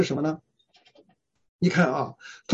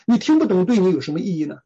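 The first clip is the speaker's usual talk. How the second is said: normal talking voice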